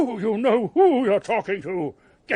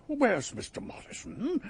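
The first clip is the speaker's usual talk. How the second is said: ordinary delivery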